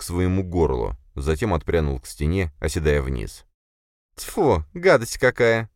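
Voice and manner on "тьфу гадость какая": high, slow, disgusted creature voice; high-pitched yuck from a small creature; spitting in disgust. A narrator's reading. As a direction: spit it out in a high little voice. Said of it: "сплюнула Лея".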